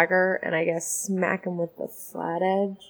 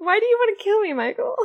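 mock whiny; 14 semitones higher, faster than usual. Player protests the GM's plan.

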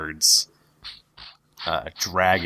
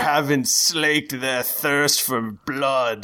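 higher, slow menacing showman; arena master voice; 6 semitones higher, slower than usual. Acted. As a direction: lean into the threat, slowly. Arena master addresses the party.

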